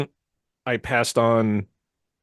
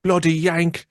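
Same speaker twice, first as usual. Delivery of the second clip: high mock British "Bloody Yank"; mock British accent; 7 semitones higher, much slower than usual. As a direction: tease in a put-on British accent. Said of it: "do a British accent".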